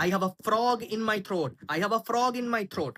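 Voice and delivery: very slow, clear teacher read; slow model phrase. Shown as a demonstration. Teacher models the English phrase, very slowly. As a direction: spell it out slowly for learners. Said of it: "কথা বলতে বলতে গলা ভেঙে গেলে".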